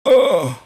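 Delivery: loud disgusted ugh; disgusted groan. Real, not acted. Streamer groaning loudly at a creepy message.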